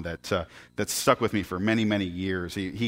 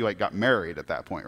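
normal talking voice

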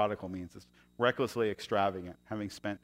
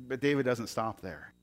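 ordinary talk, nothing marked

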